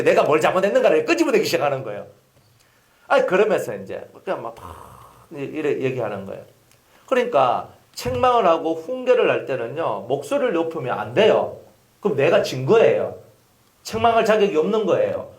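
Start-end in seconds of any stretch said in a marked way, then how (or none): none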